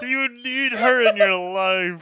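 high, loud, drawn out through laughter; hammed-up creeped-out question. Viewer replaying a cold question to the parents, laughing and hammed-up. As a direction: stretch the mock question out, laughing.

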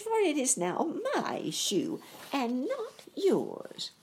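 high, slow witch voice; gloating old witch; witch retorting. A narrator's reading. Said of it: "retorted the witch".

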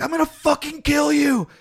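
high, strained threat from a pinned man; mock furious threat. Drunk man pinned by cops threatens them, high mock fury. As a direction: snarl it like a drunk under four cops.

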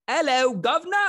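high, loud mock British greeting; British accent. Host opens the London game, mock British accent. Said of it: "a little British accent".

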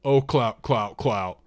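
loud, mocking imitation of a stammer. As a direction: throw his stammer back at him, loudly. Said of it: "and mimicked me".

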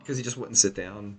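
ordinary talk

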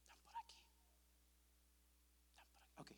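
murmured under his breath while searching for words; hushed self-talk; whispering to himself. Real, not acted. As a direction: whisper it to yourself, thinking aloud.

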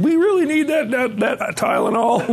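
high-pitched comic questioning; mock penny-pinching doubt. Mocks himself questioning hospital costs.

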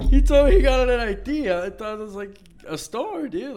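high, loud, through laughter; laughing. Real, not acted. Telling friend the I T story, laughing high. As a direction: tell it while cracking up.

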